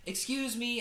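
imitated polite, high-pitched question. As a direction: ask it politely, voice lifted high. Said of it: "polite about it".

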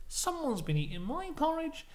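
high-pitched storybook mother bear; Mummy Bear. Acted. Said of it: "Mummy Bear goes".

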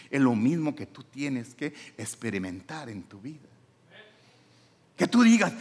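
ordinary talk

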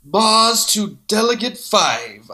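slow, grand villain vow; Khan. Acted. Fan quoting a half-remembered Khan line, grand and slow. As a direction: draw it out with theatrical menace. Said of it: "when Khan is like".

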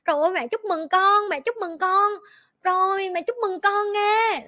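sweet put-on praise; forced cheer. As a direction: mom forcing congratulations. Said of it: "mình phải kiểu cheer up".

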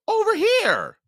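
put-on boy drawing goblins away; calling out. A narrator's reading. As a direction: lure them toward you. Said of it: "he called".

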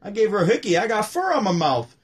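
high, loud comic delivery; Rodney punchline. Retold or imitated. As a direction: hit the punchline hard. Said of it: "maybe that was hard to say".

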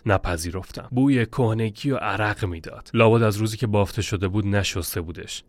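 plain reading voice

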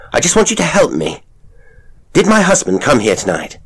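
high, loud and fast; desperate plea. Acted. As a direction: plead urgently, near shouting. Desperate wife pleads.

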